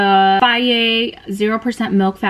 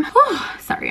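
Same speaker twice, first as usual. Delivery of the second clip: panting after the gym; breathless, catching air; out of breath; 12 semitones higher than usual. Real, not acted. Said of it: "I'm out of breath".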